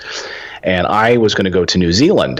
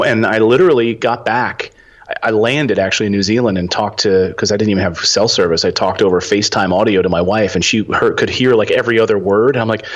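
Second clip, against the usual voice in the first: ordinary talk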